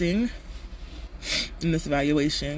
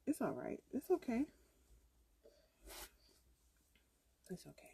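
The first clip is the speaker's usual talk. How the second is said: soothing; 5 semitones higher, 18 dB quieter than usual. Real, not acted. Soothing crying toddler.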